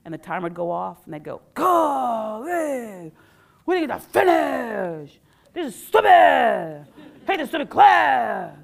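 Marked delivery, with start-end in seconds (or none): 1.5-8.6 put-on slow whining complaint from older kids; slow, loud kid whine; whiny kids. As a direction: grumble like annoyed sixth graders. Imitating grumbling fifth graders.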